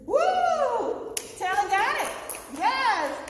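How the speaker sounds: loud, high cheering; excited cheer. Real, not acted. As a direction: celebrate the student loudly. Teacher cheers a student's success.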